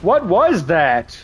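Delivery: slow, startled question; drawn out in alarm. Real, not acted. Streamer startled by something in the game, slow alarmed question.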